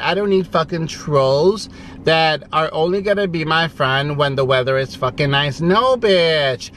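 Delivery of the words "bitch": normal talking voice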